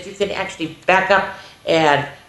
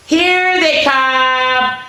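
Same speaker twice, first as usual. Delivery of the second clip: excited reveal; 6 semitones higher, 4 dB louder, much slower than usual. Acted. Dots appear in book.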